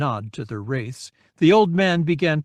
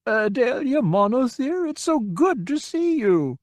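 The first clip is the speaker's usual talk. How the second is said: put-on high, quavering delight of a frail old man; frail old man beaming with joy; wavery old man; 11 semitones higher than usual. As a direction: greet a long-lost pupil with joy. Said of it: "wavery and uncertain".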